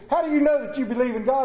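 put-on teacher rounds on the little girl, quick and loud; indignant teacher. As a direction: fire the question at the girl. Mad teacher questioning girl.

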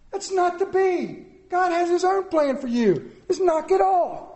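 high, loud mock telling-off; exaggerated scolding wife. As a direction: lay down the law as a fed-up wife, hammed-up. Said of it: "Not quite like that. She's much nicer".

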